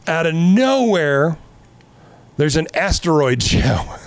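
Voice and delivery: normal talking voice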